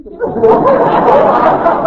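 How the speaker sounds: big high delighted laugh; hearty laugh. Acted. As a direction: burst out laughing loudly.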